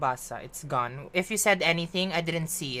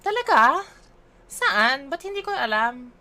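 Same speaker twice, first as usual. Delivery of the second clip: high, loud "really?"; startled disbelief; real surprise; 6 semitones higher, 7 dB louder than usual. Real, not acted. Shocked at tag notification.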